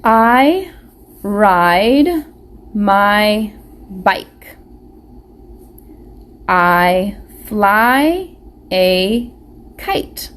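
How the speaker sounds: slow word-by-word reading aloud; slow read-along. Shown as a demonstration. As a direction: read each word slowly for children to follow. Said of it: "let's read this together".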